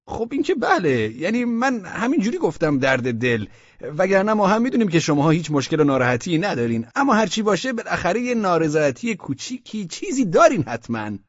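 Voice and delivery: high, loud official voice, hurriedly explaining; a bureaucrat covering his tracks; flustered official. Acted. Osman Bey backpedals to the merchants in a high, flustered official voice.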